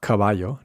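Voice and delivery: caballo with an aspirated k; breathy English c on caballo. Shown as a demonstration. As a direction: puff of air starting caballo. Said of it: "So not".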